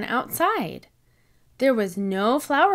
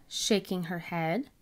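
plain reading voice

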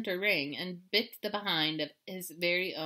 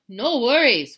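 loud dog bark of a line; woofed; 4 semitones higher, 13 dB louder, slower than usual. Acted. Dog reassuring the kitten, loud woof. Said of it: "woofed Don Diego".

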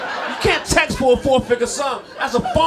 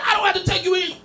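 higher, louder retort; yelling back; 10 semitones higher, 4 dB louder, faster than usual. Retold or imitated. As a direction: shout it back at him.

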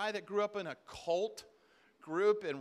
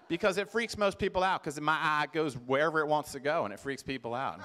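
loud imitated Alabama accent, dropping lower; Southern US accent; 5 semitones lower, 5 dB louder than usual. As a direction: mimic a blunt Southern woman explaining herself. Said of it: "this is what she said to me".

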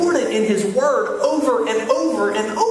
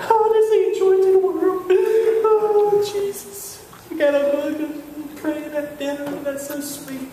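high, hammed-up weepy whine; mock crying; 9 semitones higher than usual. Man crying over a TV show, mock weepy. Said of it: "I watch Duck Dynasty and I cry".

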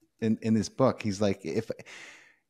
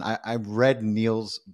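ordinary talk, nothing marked

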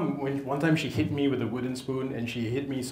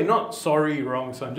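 normal talking voice

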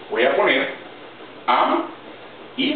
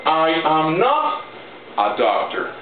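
slow dictation; slower than usual. Shown as a demonstration. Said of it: "dictating English to students".

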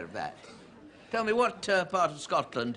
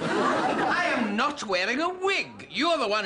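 indignant denial; 8 semitones higher, slower than usual. Acted. Peter denies wearing a wig, indignant and emphatic. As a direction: deny it hotly, word by word.

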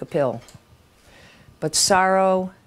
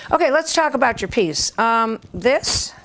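higher-pitched editor getting to business; brisk editor; 6 semitones higher than usual. Retold or imitated. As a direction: open the critique in an acted editor's voice. Acted editor opening his critique.

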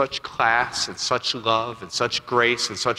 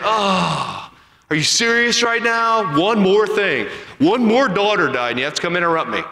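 exasperated; 6 semitones higher than usual. Pretend. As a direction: play fed up at yet another interruption. Imagined Jesus annoyed at interruption.

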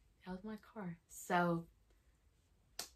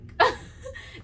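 a high, breathless burst of laughter; high breathy laugh; 7 dB louder than usual. Real, not acted. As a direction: laugh out at the memory. Laughing at bike memory.

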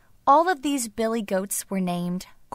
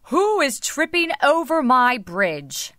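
higher, emphatic troll challenge; roaring troll; 5 semitones higher, 3 dB louder than usual. A narrator's reading. Troll challenging the youngest goat, raised roaring voice. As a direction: challenge them like a storybook troll. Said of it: "roared the troll".

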